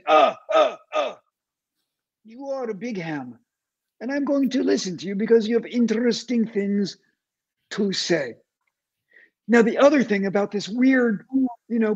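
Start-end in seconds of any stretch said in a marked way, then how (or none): 0.0-1.2 lightly mimicked doddering grunts; Grandpa Simpson. Therapist mocking patient's stammer, imitated old-man grunts. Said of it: "you are all Grandpa Simpson". 2.2-8.3 imitated blunt Israeli accent; deadpan mimicry of an Israeli therapist; mimicked Israeli commando therapist. Therapist conceding to her patient, imitated Israeli accent. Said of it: "sometimes I try to mimic her".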